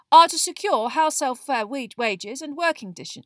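loud Hermione. A narrator's reading. Girl announcing aims as though she hadn't heard, loud. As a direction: talk loudly over the objection. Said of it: "speaking even more loudly than Ron".

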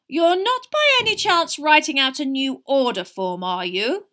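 high, loud, shrewd suspicion. A narrator's reading. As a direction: probe the twins as if seeing through them. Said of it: "said Missus Weasley shrewdly".

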